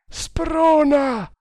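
high, far-carrying yell; urgent shout. A narrator's reading. Nagor urging the rider to spur on, shouting. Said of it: "gridò Nagor".

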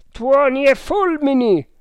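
high, loud outburst; yelled curse. A narrator's reading. Man on the terrace raising the alarm, furious high yell. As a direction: bellow it high and full force. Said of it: "urlò una voce".

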